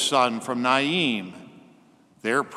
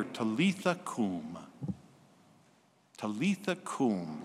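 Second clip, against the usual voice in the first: soft, slow and gentle "Talitha cum"; gentle Aramaic; 5 semitones lower, 8 dB quieter than usual. Acted. Jesus calling the dead girl gently, soft and slow. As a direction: breathe "Talitha cum" softly, tenderly. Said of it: "so gentle and beautiful on the ear".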